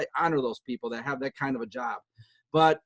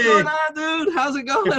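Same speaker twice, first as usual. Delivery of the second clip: mock kid voice; 11 semitones higher, 5 dB louder, slower than usual. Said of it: "He's like".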